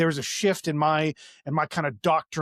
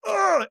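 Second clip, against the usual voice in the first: high, re-enacted growl of exasperation; exasperated grunt; 4 dB louder than usual. Speaker fed up with man in his face, exasperated grunt. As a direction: grunt as if about to shove. Retold outburst before shoving him.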